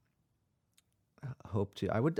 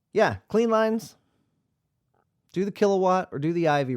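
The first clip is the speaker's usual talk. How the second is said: hyped band plug; 8 semitones higher, 7 dB louder than usual. Acted. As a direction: call it out like an emcee hyping the crowd. Plugs the band's name again.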